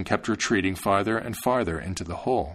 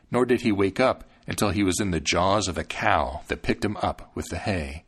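plain reading voice

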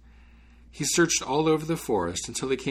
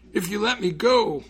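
high, loud pleading call; 11 semitones higher, 6 dB louder than usual. A narrator's reading. Bottled spirit bargaining with the student, calling out. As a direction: call after someone who is leaving, high and strained. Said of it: "called the spirit".

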